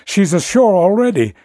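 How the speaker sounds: the mate wails in panic; wailing. A narrator's reading. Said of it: "he wailed".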